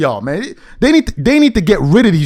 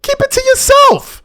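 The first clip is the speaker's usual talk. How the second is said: much higher, yelled in disbelief; exasperated yell. Real, not acted. Telling online critics to stop, yelled in frustration. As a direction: throw it back at them, fed up.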